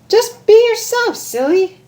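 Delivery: very high playful advice; Pinkie Pie voice. Acted. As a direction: chirp it like it's obvious. Pinkie advises Rainbow.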